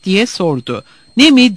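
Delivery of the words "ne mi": loud, high, indignant outburst; thundering. A narrator's reading. Divitoğlu challenged about his foreign food, thundering. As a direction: thunder the question back. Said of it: "diye gürledi Divitoğlu".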